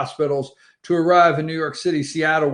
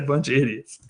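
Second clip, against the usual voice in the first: repeated quote; 4 semitones lower, 4 dB quieter, faster than usual. Retold or imitated. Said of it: "He said".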